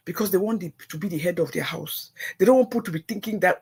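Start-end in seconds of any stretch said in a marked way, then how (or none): none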